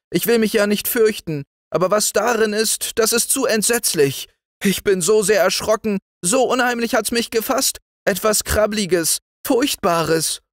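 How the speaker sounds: put-on very high, fearful pleading child; a frightened girl clinging to her uncle; terrified. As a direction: beg him not to be cross, voice full of fright. Child terrified by something in hole.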